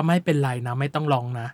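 a hint of Phil soothing his mother; soft comforting quote. Host quotes Phil comforting mom in the car, softly. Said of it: "แล้วฟิลบอกว่า".

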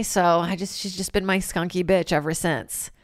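ordinary delivery

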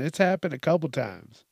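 normal talking voice

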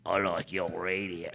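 slow, low creepy servant voice; low put-on creature voice. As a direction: drawl a compliment like a creepy house creature. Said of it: "You sound like Creature".

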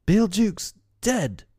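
high, drawn-out cry of disbelief; shocked cry. A narrator's reading. Pirates hearing of their mate's death, shocked cry. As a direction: cry out slowly, pitched right up. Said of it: "cried the pirates".

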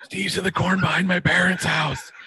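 astonished stage whisper rising high; retold whisper. Hider turns to Brett with news, urgent whisper. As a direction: whisper the shocking news to your friend. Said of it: "repeat whisper to me".

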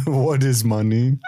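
slow, dopey pretend bear. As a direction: put on a slow cartoon bear voice. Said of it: "That's my bear voice".

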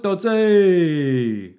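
high, loud, drawn-out shout; long laddish holler; mock nickname cheer. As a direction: bellow the nickname like greeting a mate across a pub.

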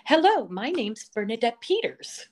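a hint of a gracious star introducing herself; quoting a star. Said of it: "she turns to me and she says".

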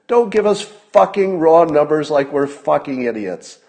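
real anger at the task force numbers; really mad. Real, not acted. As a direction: lay into them, furious. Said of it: "I'm getting really mad".

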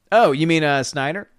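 ordinary delivery

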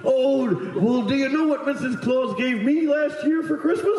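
high-pitched jolly Santa patter; Brooks. Retold or imitated. Santa teasing grown-ups with a question, high jolly voice. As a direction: set up a dirty joke in a merry Santa voice. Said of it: "what he would do when adults came in".

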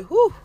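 high, loud breath of relief; relieved whew. Shown as a demonstration. Showing listeners how to react to hard times, with a relieved whew. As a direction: let out a big sigh of relief. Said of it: "just say".

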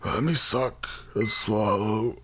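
slow, weak and strained at first, then high and strained; dying man voice. Pretend. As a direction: read it like a man on his deathbed. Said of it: "read this in the voice of a dying man".

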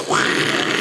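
imitated rushing spray sound; voiceless whoosh of uncontrolled flow; gushing noise. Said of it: "It just, like".

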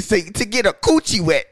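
ordinary talk, nothing marked

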